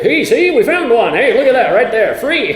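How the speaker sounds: thrilled dad finding the right bolt; excited dad. Retold or imitated. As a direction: crow over the free part. Voicing dad finding a part.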